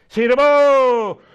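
loud, high, drawn-out cry; sudden ringing shout; prayer shout. Real, not acted. Shouted outburst in deliverance prayer.

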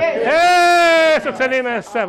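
slow, high, loud drawn-out cry; fervent shout. Real, not acted. Preacher crowning the prayer, ecstatic shout. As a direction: stretch it out as a ringing shout.

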